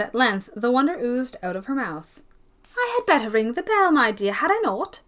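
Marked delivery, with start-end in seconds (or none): 2.8-5.0 higher, louder brisk offer; a brisk lady. Acted. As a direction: say it briskly, eager to act. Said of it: "said Lady Glenmire briskly".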